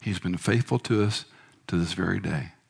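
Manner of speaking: soft tender words ending in a whisper; tender reassurance. Retold or imitated. Wife gently reassuring her suffering husband, soft and tender. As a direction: quietly reassure a husband in pain. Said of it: "she looked at me and she said".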